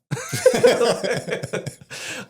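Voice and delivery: bursting into a high, loud laugh. Real, not acted. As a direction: crack up at the memory. Cracking up at own story.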